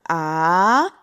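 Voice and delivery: long "ah" sliding steadily upward; rising second tone. Shown as a demonstration. Teacher demonstrating Mandarin second tone, rising "ah". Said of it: "Second tone".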